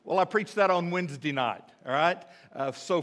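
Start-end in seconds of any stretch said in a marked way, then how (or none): none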